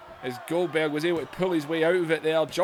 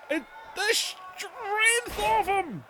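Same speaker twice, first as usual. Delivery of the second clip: higher, slow, strained cry of awe; awed cry; 14 semitones higher, slower than usual. Real, not acted. Awed at Goldberg's strength.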